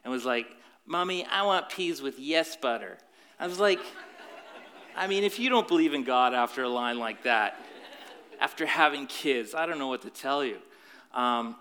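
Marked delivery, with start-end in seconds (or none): 0.8-3.0 little kid cartoon voice; cartoon toddler voice. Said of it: "three-year-old cartoon voice". 3.4-10.6 excited. Real, not acted. Preacher's amused exclaim after quote.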